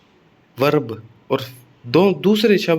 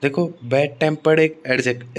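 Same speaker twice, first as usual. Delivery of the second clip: ordinary delivery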